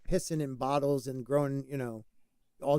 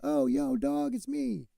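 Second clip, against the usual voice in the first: soft, loosely attempted rapper impression; 9 semitones higher, 4 dB quieter, slower than usual. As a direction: lightly imitate a rapper, pitch lifting at the end. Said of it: "was my cube, bro".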